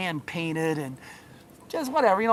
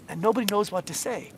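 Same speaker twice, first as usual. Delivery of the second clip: ordinary delivery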